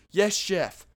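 sarcastic shout. Retold or imitated. Cook yelling back at screaming managers, sarcastic. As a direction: fire it back at them, mocking. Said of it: "I would just scream".

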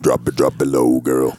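very low, full-level bass; deep bass voice. Pretend. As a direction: boom it from the bottom. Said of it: "I don't know many people that can go".

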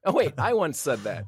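sudden realization. Real, not acted. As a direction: catch yourself mid-rant.

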